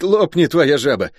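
high, excitable soldier greeting a friend; high-pitched, exuberant guardsman voice; boisterous guardsman, delighted. Acted. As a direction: pipe it up in joyful surprise. Guard Luk greets his friend.

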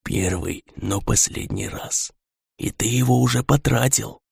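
very soft, slow whisper; hushed, measured whisper; whispered warning. A narrator's reading. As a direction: breathe it out like an unseen mind-eater. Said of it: "прошептал".

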